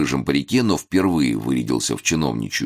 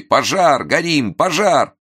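high-pitched shouts of alarm; man's frantic cry; 12 semitones higher, 4 dB louder, slower than usual. A narrator's reading. Man joins the woman's cries of fire, frantic shouting. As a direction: take up the scream, high and urgent. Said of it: "Тут же подхватил и мужской".

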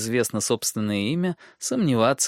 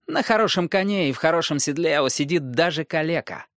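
sly dwarf voice; 5 semitones higher than usual. Acted. Tyrion insists.

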